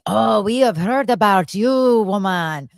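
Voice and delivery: mock-haughty French accent scolding. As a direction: retell it in a put-on French accent. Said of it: "this French accent started coming out of her mouth".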